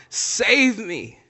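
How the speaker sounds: hammed-up desperate cry; mock cry for help. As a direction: cry out like a sinking man, acted. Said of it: "he's crying out".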